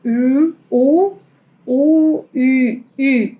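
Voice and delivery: "U" sung out as a rounded ü; ü drill; German ü. Shown as a demonstration. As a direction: repeat "U" as ü, lips round. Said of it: "Ese es el sonido".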